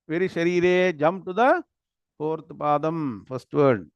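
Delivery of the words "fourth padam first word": normal talking voice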